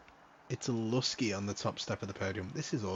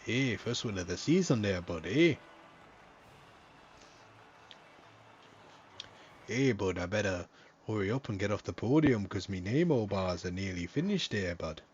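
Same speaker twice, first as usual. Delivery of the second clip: louder, hammed-up Canadian accent, bud; 4 dB louder than usual. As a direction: play a jokey Canadian podium interviewer. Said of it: "in my Canadian accent".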